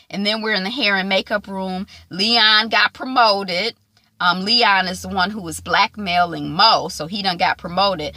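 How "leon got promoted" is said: normal talking voice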